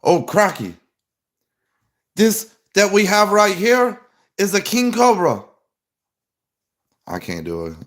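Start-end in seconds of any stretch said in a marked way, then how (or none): 0.0-5.4 high, loud attempt at an Australian accent. Shown as a demonstration. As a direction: have a go at Australian, like a wildlife host showing off a snake. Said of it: "see if I can do Australia".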